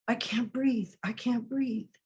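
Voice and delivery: breathless, quiet plea; hushed gasping plea. Acted. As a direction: gasp it out as if smothered. Quoting victim being smothered.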